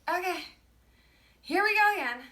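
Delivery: fed-up sigh of here we go again; exasperated. Retold or imitated. Hanging up on racist boyfriend.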